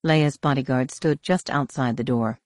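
ordinary delivery

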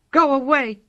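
scared kitten warning off an owl; fierce. A narrator's reading. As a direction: puff up and drive the owl off. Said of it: "he said fiercely".

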